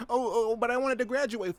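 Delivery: whimpering falsetto complaint; whiny victim. Pretend. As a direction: plead like a crushed student. Whiny victim answers the Jersey man.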